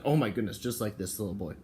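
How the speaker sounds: usual speaking voice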